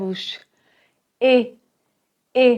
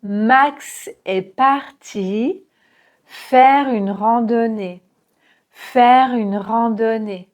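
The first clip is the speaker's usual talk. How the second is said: Max est parti very slowly, open A; slow demo of Max; slow model; slower than usual. Shown as a demonstration. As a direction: drag it out for learners. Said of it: "Prononcez bien le A".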